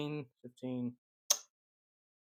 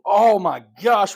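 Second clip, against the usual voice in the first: excited; 4 semitones higher, 9 dB louder, much slower than usual. Real, not acted. Dealer excited by winning roll.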